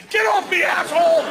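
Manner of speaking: high, loud re-enacted shout; imitated angry cameraman hollering; furious mock yell. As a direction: yell to shake off someone clinging. Cameraman kicking away panicked jumper.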